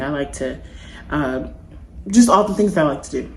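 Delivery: usual speaking voice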